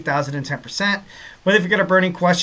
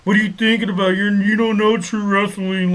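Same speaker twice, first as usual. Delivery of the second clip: angry commenter; 5 semitones higher, 4 dB louder than usual. Retold or imitated. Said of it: "be like".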